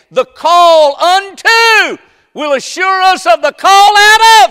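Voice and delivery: high, shouted preaching cry; full-force preaching. Acted. Preacher shouting to the congregation, full-force preaching. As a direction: preach it out to the whole church.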